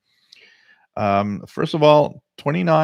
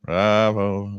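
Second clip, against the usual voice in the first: flat, machine-like robot voice; robotic voice; 4 dB louder, much slower than usual. Retold or imitated. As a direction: say it like a cold robot. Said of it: "you sound so robotic".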